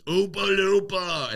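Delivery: high, drawn-out heckler yell; mocking heckle called out high and slow. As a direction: shout it from the back of the room. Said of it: "a guy yells out from the audience".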